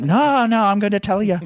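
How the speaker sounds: high, loud hammed-up refusal; mock insistent yell. Wrestler refusing to stop his promo, loud mock yell. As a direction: shout him down like a ranting wrestler.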